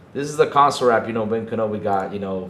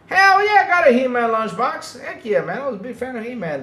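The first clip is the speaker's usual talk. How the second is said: high-pitched fan enthusiasm, loud at first; excited fan; 11 semitones higher, 5 dB louder than usual. Real, not acted. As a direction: burst out high, then rush on. Fan delighted by He-Man lunchbox.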